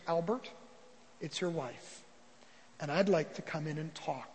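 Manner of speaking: soft, low, conciliatory; humble, gentle answer. Acted. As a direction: quietly offer peace through the door. Humbled queen answers gently at door.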